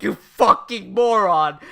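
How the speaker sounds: slow, loud retold insult; mock exasperation. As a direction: lean on each word in mock anger. Acting Light's anger at Misa.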